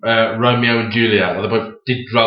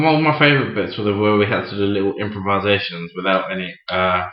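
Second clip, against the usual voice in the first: usual speaking voice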